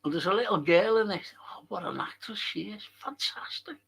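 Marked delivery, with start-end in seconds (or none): 1.4-3.8 hushed, breathy whisper of admiration; real whispered awe; awed whisper. Real, not acted. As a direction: whisper it like you can't believe her talent. Awed aside about the actress.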